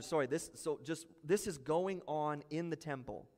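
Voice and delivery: usual speaking voice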